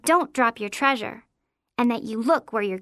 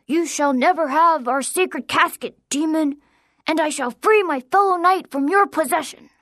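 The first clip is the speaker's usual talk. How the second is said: louder, heroic vow; defiant knight; 4 semitones higher, 5 dB louder than usual. A narrator's reading. Boy vows to free his possessed friend, loud heroic knight voice. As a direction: defy the demon like a play-acting hero. Boy defies the pretend demon.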